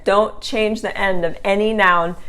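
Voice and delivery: ordinary delivery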